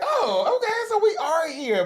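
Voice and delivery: high, amazed realization; surprised discovery. Retold or imitated. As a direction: act stunned to find them. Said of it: "I was like".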